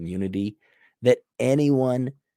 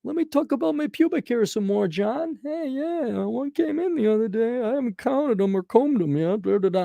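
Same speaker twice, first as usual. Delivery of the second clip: high, silly mock voice of chatty guests; hammed-up high mock voice; mock dull normie. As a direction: lampoon the small-talkers at dinner. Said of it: "They're like".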